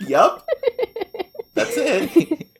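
squealing laugh then high yep; cackle, then confirm. Real, not acted. Laughing at joke.

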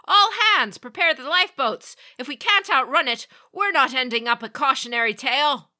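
loud, high commanding shout; barked orders. A narrator's reading. Captain shouting orders to abandon ship. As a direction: snap the orders out to the crew. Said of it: "he snapped to attention".

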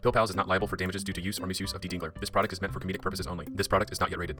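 rapid, quiet legal fine print; fast disclaimer. Acted. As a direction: rattle off the small print.

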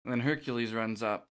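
ordinary talk, nothing marked

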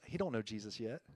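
dropped low and soft, letting it sink in; hushed repeat. Real, not acted. Pastor softly tells the congregation about his neighbor, hushed. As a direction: say it quietly, almost to himself. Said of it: "Guess what?".

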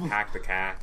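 mock Boston accent with dropped r. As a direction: have a brief go at a Boston accent. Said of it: "What about Boston?".

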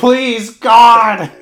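loud, high mock-desperate shout; over-the-top begging yell; exasperated Josh. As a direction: cry out pleading, hammed-up. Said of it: "And Josh's like".

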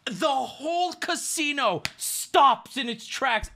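high, drawn-out shouts of triumph; slow, high-pitched hype storytelling; ecstatic win. Retold or imitated. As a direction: relive a huge win at full hype. Reliving his blackjack win to friends.

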